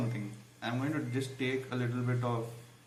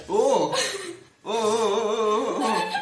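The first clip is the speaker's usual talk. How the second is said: excited; 9 semitones higher, 9 dB louder, much slower than usual. Real, not acted. Rival cheating during spicy challenge.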